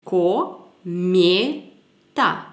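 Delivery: Ko, me, ta split into separate syllables. Shown as a demonstration. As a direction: say Ko, me, ta one by one. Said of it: "Il y en a trois ici". (syllabe par syllabe, Ko, me, ta)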